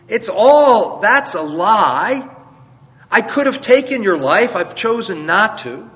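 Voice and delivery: high-pitched, earnest preacher's plea; humble pleading. Acted. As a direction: plead your innocence, humbling yourself before a king. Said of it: "He really humbles himself before Saul".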